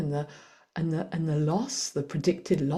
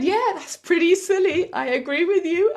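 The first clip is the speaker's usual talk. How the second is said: sheepish agreement; 11 semitones higher, 5 dB louder than usual. Retold or imitated. Mother admits silly mistake.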